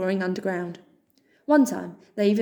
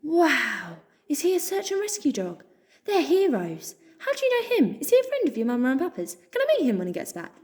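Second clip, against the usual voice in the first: high, fast breathless questions; excited chatter; 7 semitones higher than usual. Acted. Joe thrilled about the rescue dog, fast and high. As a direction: tumble out question after question. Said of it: "a gasp of excitement".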